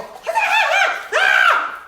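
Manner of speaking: loud high startled shrieking; high battle-cry scream with a laugh; ninja scream. Retold or imitated. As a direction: shriek and strike like a ninja warrior. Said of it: "and I go".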